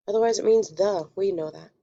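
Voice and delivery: ordinary talk, nothing marked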